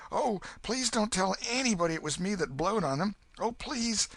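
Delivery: put-on a frightened boy begging for secrecy; scared boy Huck. Huck begs the old man to keep his name secret, high and scared.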